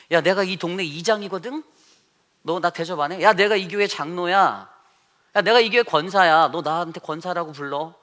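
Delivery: hammed-up arrogant church elder, loud; mock bossy boasting. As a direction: lord it over the listener, demanding respect. Mimics self-important church elder.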